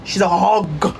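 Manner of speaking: husky voice leaning on "hog"; husky emphasis. Shown as a demonstration. Coaching listeners on the insult, husky and emphatic. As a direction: put husk into a drawn-out "hog". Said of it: "Put the husk in your voice when you say".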